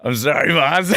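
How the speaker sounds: real laughter through the words; giggly and breaking up; laughing apology. Real, not acted. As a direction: apologize while cracking up. Cracks up at failed accent.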